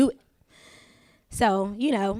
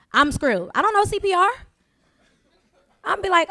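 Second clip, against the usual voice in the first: ordinary delivery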